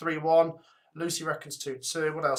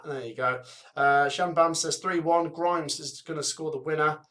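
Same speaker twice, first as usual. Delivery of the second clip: ordinary talk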